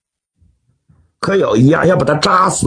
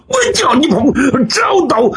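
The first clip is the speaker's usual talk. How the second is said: high, fierce attack cry; attacking battle cry; 10 semitones higher than usual. Acted. Long Tianbiao stabbing the man fleeing to the window, fierce cry. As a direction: throw it out high as you strike.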